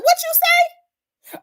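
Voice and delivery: mock outrage, high and drawn out; incredulous what you say. Mock reply to pimp.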